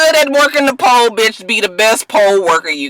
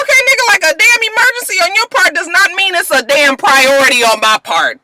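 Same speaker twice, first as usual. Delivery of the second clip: sassy retort; 7 semitones higher than usual. Retold or imitated. Commentator mocking Willie's emergency, high and sassy. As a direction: tell him off with attitude. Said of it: "I was just like".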